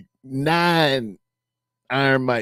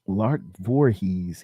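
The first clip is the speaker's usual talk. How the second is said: ordinary talk, nothing marked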